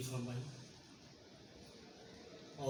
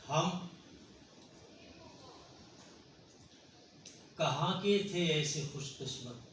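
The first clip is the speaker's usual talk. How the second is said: raised, declaiming mushaira voice; poem recitation; 4 semitones higher than usual. Acted. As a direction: recite the verse to the hall. Poet begins reciting a couplet.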